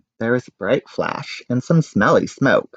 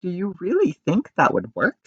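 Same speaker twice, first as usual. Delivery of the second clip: sobbing; 7 semitones higher than usual. A narrator's reading.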